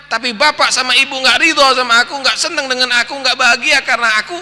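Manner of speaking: high, loud earnest plea; earnest child pleading. Acted. Pious child pleading with crying parents, high and loud. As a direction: beg his parents to see what truly matters.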